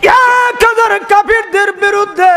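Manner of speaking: high, melodic pleading call; imitated impassioned plea. Preacher voicing the Prophet praying before battle, high melodic plea. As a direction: lift it high in sing-song supplication. Voices the Prophet's anguished prayer.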